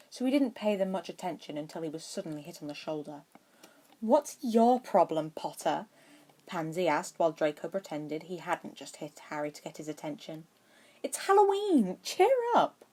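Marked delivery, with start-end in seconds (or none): 4.0-5.9 louder, slow pointed jab; Pansy prodding a brooding Harry, loud and pointed; challenging. A narrator's reading. Pansy confronting a gloomy Harry. 11.0-12.8 much higher, loud and bouncy; Pansy chiding Harry to cheer up, high and excited. A narrator's reading.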